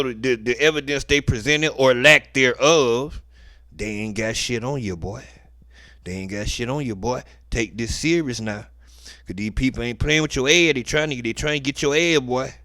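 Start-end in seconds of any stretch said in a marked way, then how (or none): 3.7-10.0 low confiding aside. Retold or imitated. Quiet aside voicing the judge.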